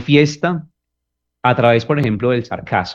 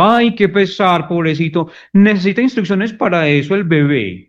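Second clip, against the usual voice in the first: mocking a partner who asks for help; mocking baby talk; 8 semitones higher than usual. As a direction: belittle them like a helpless baby. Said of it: "genera sentimiento de humillación".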